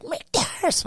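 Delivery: high, strained mock yell held down in volume; sergeant yelling name. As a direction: imitate the sergeant calling the surname. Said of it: "it says it again".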